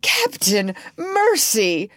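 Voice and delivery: high, whimpering appeal; whimpered plea. A narrator's reading. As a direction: beg the captain for mercy, trembling. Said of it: "Starkey whimpered".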